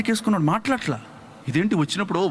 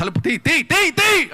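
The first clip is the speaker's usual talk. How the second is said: God calling at the door; 6 semitones higher, 4 dB louder, slower than usual. Retold or imitated. God knocking at the sleeper's door.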